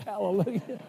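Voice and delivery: a real amused hallelujah; laughing hallelujah. Real, not acted. Laughing at brother's joyful fit.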